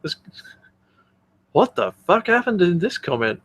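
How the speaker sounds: usual speaking voice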